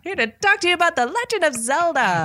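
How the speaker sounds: newscaster voice. Pretend. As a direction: announce it like a TV newscaster. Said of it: "it sounds like a newscaster".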